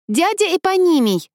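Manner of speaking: high, loud eager call; exclaiming. Acted. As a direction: call out to your uncle across the deck. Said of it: "воскликнула Мошка".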